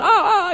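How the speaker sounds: high-pitched mock "ah" of pain; pained yelp. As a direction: imitate a sore-shoulder cry. Imitates pain as shoulder is fixed.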